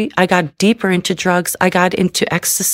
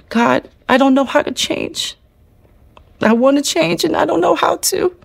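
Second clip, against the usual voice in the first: tearful prayer; 8 semitones higher than usual. Retold or imitated. Praying alone in a cold jail cell, tearful and high. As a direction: voice rising high and breaking as she prays. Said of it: "and I was crying".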